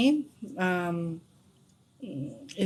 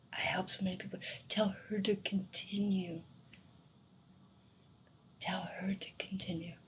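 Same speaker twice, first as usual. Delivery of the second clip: very soft, half-whispered; hushed, moved; 13 dB quieter than usual. Retold or imitated. Repeating her dead husband's message to herself, hushed. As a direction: let the words sink in quietly, with pauses.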